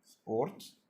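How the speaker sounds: سبورت vorgesprochen mit R; سبورت langsam vorsagen; model word sport. Shown as a demonstration. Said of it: "خلنا ناخذ سبورت".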